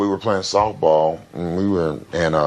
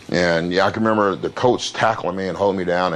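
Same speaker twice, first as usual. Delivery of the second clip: ordinary delivery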